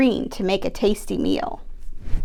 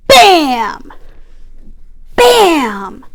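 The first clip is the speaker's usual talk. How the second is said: bam; 5 semitones higher, 10 dB louder than usual. Acted. Goat charges the troll.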